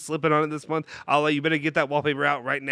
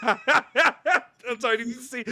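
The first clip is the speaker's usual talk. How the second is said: laughing; 9 semitones higher, much slower than usual. Real, not acted. Laughing after surprise gift.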